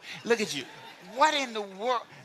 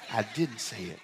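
ordinary talk

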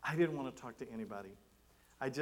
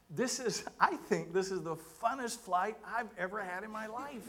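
high-pitched gushing praise; retold enthusiasm; 7 semitones higher than usual. Seatmate thanking the pastor after landing, retold with high enthusiasm. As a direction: gush in a raised voice as the delighted seatmate.

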